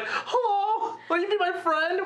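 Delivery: high, laughing Olaf voice; squeaky snowman voice, giggling; talking doll. Pretend. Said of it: "He's just like".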